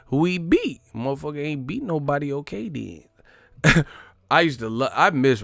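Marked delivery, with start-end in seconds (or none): 0.1-3.8 high-pitched dismissive impression, drawn-out ending; higher mocking voice, stretching the last words; Roger Mayweather. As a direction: imitate a blunt old trainer, unimpressed. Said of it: "Roger Mayweather voice".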